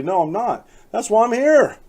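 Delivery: imitating a frank, emphatic comeback; candid reply. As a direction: read out a brazen admission, rising in pitch. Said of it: "Dudek replies candidly".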